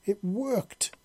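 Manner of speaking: high, pleased little cheer; happy triumph. A narrator's reading. Queen triumphant that her jelly worked, happy. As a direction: savour the win. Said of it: "she said happily".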